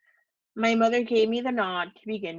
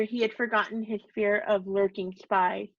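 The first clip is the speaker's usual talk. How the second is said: ordinary delivery, nothing marked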